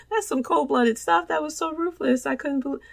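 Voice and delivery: high-pitched laughing disbelief; amused, half-laughing marvel. Real, not acted. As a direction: laugh through it in shock. Laughing at ruthless wrestling moment.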